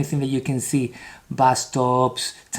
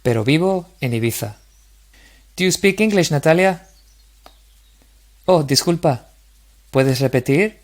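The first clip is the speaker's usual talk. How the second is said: model dialogue reading; 3 dB louder than usual. Shown as a demonstration. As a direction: read each line out clearly for repetition. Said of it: "try to imitate my same intonation".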